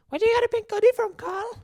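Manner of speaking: high-pitched, hurried mock questioner; quick, loud falsetto mimicry; nagging fan. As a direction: squeal the question like a pestering fan. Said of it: "all I ever get is".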